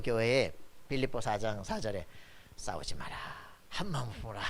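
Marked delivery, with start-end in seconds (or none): 2.6-4.4 quoted softly, in a lowered voice; low, soft mock-solemn plea; gentle admonition. Preacher quoting scripture to two quarrelling women, gentle admonition.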